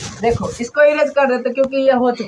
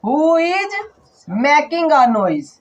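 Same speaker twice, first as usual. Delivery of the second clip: slow dictation; much slower than usual. Shown as a demonstration. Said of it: "दूसरे तरह से भी बोल सकते हैं".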